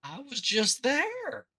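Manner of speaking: high, slow exasperated whine; stretched-out high whine of a fed-up player; whiny NPC complaint. Retold or imitated. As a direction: whine it like a put-upon game hero. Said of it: "guy over there".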